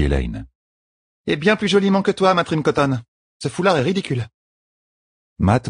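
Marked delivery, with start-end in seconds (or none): 1.3-4.3 much higher, haughty retort; high-pitched queenly teasing; teasing young queen. Acted. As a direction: put him down over his looks. Voices Elaine teasing Matt.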